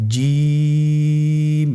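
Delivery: জিম held for three alif, the vowel stretched; drawn-out Jim. Shown as a demonstration. Teacher showing the class how to pronounce জিম. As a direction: demo জিম slowly and clearly. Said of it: "আপনারা একটু লক্ষ্য করুন".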